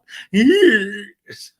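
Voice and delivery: high, loud drawn-out squeal; crazy-emoji squeal. Pretend. Host picturing emoji underwear, crazy-emoji squeal. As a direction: squeal like a goofy emoji face. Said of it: "thinking of that crazy emoji".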